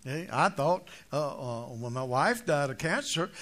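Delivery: ordinary talk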